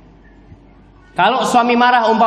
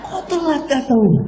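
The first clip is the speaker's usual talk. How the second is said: higher, lightly hammed-up questioning wife; mock reproachful wife; 7 semitones higher than usual. Wife asking husband why he came late, mock reproachful. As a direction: question him in a raised, mock-wifely pitch.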